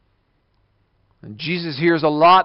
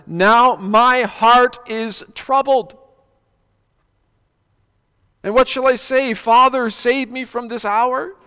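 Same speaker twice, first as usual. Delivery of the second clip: troubled Jesus; 8 semitones higher, 5 dB louder, slower than usual. Acted. Jesus's troubled words voiced.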